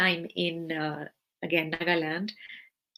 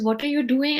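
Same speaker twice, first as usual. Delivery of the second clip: high, louder caring question; Guruji asking; 7 semitones higher, 6 dB louder than usual. Retold or imitated. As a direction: call out a cheerful question. Guru asks her on phone.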